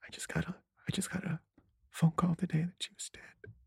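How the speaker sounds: high, tearful whisper; fake crying. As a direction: whisper it through put-on tears. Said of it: "And I'm crying and I'm crying".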